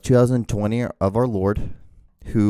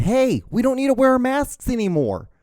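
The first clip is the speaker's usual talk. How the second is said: mocking quote; 11 semitones higher than usual. Mocking anti-maskers quote.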